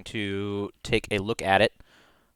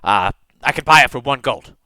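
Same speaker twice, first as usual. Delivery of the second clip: a light, pushy merchant voice, higher and louder; haggling kobold smith; 3 semitones higher, 7 dB louder, faster than usual. Acted. Kobold smith haggles over flail.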